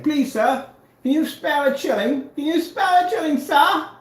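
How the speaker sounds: high, pleading beggar voice; mock beggar. Taunting a rival as a street beggar, high pleading whine. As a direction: beg pitifully for spare change.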